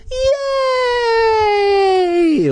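long, high-pitched squeal; screaming cheer. A narrator's reading. Boy winning the trip, screaming cheer. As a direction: scream it out in drawn-out joy. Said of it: "Luke screamed".

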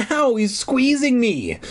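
put-on high, drawn-out little-girl whine; wailing. Little girl squeezed by the dummy, wailing. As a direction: wail it like a hurt child. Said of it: "Alicia wailed".

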